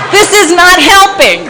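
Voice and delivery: laughing exasperation. Real, not acted. Laughing at absurd advice.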